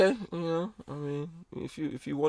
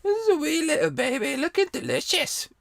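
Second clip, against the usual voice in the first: much higher, hammed-up Scottish glutton cooing over a wee baby; Fat Bastard impression; 11 semitones higher than usual. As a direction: croon the wee little baby line like a hungry Scottish brute. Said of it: "was the name of that character? Was Fat Bastard".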